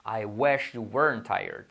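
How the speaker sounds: very slow, drawn-out English example; slow model sentence. Shown as a demonstration. Teacher models English example for learners, slowly. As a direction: spell out each word unhurriedly.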